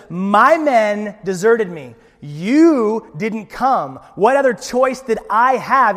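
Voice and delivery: loud, high, very slow whining; whiny excuse. Acted. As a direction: drag out each excuse like a sulky child. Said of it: "blame shifting and excuse making".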